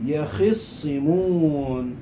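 يخصمون drawn out as "ya-khis-si-muuun"; very slow, deliberate يخصمون; slow Quranic quotation. Shown as a demonstration. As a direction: stretch يخصمون slowly, holding the long final vowel. Slowly quoting Quranic word form.

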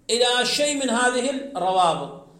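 ordinary talk, nothing marked

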